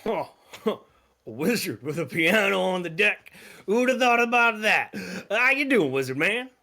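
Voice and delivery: high, loud, hearty cook voice; a booming cheerful sea cook greeting a wizard; jolly ship cook. Acted. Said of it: "He goes".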